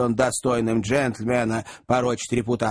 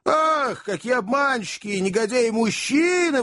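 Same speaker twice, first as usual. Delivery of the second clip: high, outraged exclamation about deceitful men; exclaiming; 9 semitones higher than usual. A narrator's reading. Betrayed widow exclaiming against all men, high and indignant. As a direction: cry out Ах in a high indignant burst. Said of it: "восклицала она".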